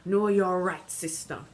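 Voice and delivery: loud called-out agreement; shouting back. Acted. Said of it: "an elder shouts back".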